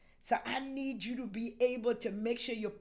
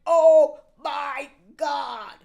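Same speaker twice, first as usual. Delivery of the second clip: slow, loud amazed cry; stunned shout; 2 semitones higher, 12 dB louder, much slower than usual. Pretend. Pastor acting a believer stunned by a blessing. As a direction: cry out in disbelief. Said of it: "you be like".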